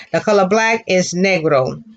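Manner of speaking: normal talking voice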